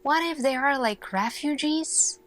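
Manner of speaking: usual speaking voice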